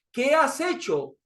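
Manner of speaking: Pilato's question. Acted. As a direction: ask it slowly as Pilato, a hint of suspicion. Pastor voices Pilato again.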